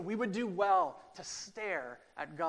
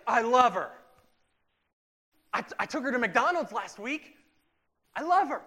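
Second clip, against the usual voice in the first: louder, higher smitten insistence; insisting Doug; 7 semitones higher, 11 dB louder than usual. Retold or imitated. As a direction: keep swearing your love, louder.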